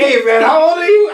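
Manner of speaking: high, loud joking yell; mock shout. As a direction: yell it like a careless gatekeeper. Mock school intake.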